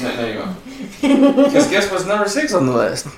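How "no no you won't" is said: slow, louder teasing through a laugh; laughing tease. Real, not acted. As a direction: laugh as you shut them down.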